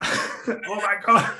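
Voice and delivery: slow, high, drawn-out gasp of disbelief; amazed at the win; stunned. Retold or imitated. Athlete hearing her name called, stunned and slow. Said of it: "you were like".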